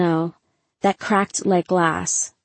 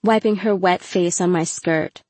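plain reading voice

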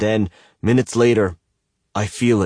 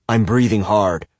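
ordinary delivery

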